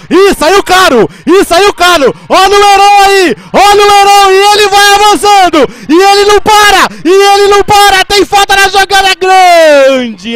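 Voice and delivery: high, loud breathless run call; excited shout. Real, not acted. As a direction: shout as he keeps running. Long run called out.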